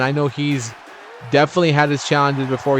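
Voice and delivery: normal talking voice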